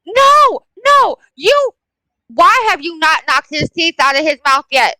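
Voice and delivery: exasperated cry of parental outrage; indignant outburst. Retold or imitated. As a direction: burst in appalled, pitch leaping way up. Outraged at mother's gentle response.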